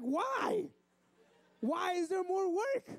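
very high, drawn-out mock wail; crying kid. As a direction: whine like a betrayed first grader. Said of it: "and he's crying".

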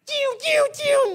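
very high shouted mock ricochet sounds; dodging noise. A kid dodging his friend's pretend bullets, high squealing noises. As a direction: squeal the bullets whizzing past. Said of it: "I'd say".

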